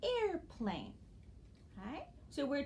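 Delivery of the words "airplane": "airplane" in two measured syllables, high start then falling; teacher presenting "airplane" as two joined parts; syllable blending demo. Shown as a demonstration. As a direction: sound out "airplane" as air, plane. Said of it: "that makes a new word".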